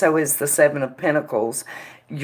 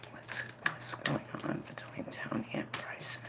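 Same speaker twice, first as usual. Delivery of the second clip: whispered plea to the spirit; whispering; 6 semitones lower, 18 dB quieter than usual. Real, not acted. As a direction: breathe it out, barely voiced. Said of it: "whispered invocation to the spirit".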